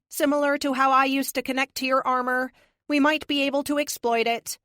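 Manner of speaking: crisp synthetic AI voice. A narrator's reading. Epic the AI voice.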